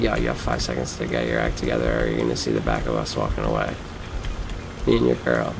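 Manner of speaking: low, quiet tough-guy warning; mock tough threat. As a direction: threaten them in a hushed, low voice. Retelling his threat to the guys.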